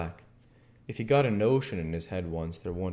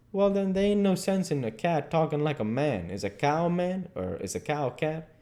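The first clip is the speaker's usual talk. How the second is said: lightly voiced dialect argument; Jim, reasoning; 7 semitones higher than usual. Jim turns Huck's cat logic round, reasoning. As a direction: build the counter-argument in dialect.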